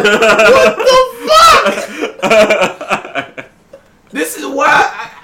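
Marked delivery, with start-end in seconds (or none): 0.0-3.5 very drawn-out, higher, louder cry of disbelief; exasperated outburst. Real, not acted. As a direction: stretch every word in baffled outrage. Baffled outburst after mimicking mumble rap.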